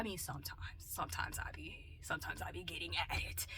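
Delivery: soft voiceless whisper; whispered admission about cussing; whispered confession. Real, not acted. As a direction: admit it under your breath. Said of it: "confessing cussing".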